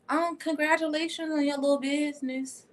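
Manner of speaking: high, put-on fake-sweet voice, sneering at the little business; sarcastic mock congratulations. Friend mocking your little business, fake congratulations. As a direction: purr out a fake compliment.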